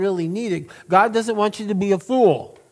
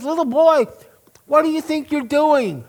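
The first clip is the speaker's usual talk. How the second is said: put-on "Little boy" called out high to a child; man questioning boy; 7 semitones higher than usual. Pastor voicing the man calling to the boy.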